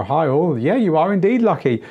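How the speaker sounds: usual speaking voice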